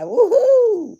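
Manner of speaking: high, loud happy whoop; excited whoop. Real, not acted. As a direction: cheer it out high. Cheering about fireworks.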